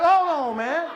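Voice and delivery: high, loud mock protest; alarmed objection. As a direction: halt everything, acting appalled. Acted alarmed objection to script.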